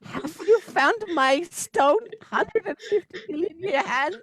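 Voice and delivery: hammed-up high singer impression. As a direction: intone it high like a possessing spirit. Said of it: "I have taken over this man's body".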